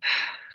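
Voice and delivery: long, voiceless breath out; audible sigh. Shown as a demonstration. Meditation guide showing listeners a big exhale, sighing.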